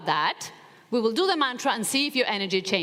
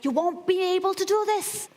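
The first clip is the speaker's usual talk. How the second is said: inner critic voice; 8 semitones higher than usual. Acted. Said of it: "like a bodybuilder screaming inside of you".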